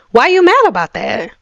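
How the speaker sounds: mock sassy challenge; sassy jab. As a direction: toss it at the haters. Mocking a hater's attitude.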